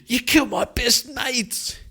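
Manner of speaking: high, strained voice of a furious bandit; enraged bandit. Acted. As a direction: cry out the loss of his mates. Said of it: "He turns and goes".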